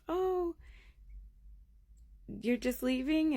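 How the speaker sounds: high, drawn-out polite surprise; nosy neighbor woman. Retold or imitated. Neighbor wife probes the departure, high and curious.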